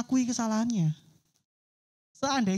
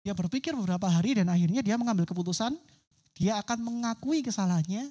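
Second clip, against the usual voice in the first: ordinary talk, nothing marked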